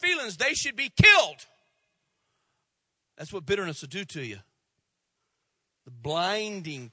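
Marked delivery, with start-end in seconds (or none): 0.4-1.5 loud, bitter demand; voicing a bitter person, loud and harsh; angry outburst. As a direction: spit it out like a grudge-holder.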